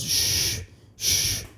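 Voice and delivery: soft, drawn-out shushes; urgent hushing. Retold or imitated. Trembling Charles hushing his friend, urgent shushing. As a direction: shush the friend twice, breath only.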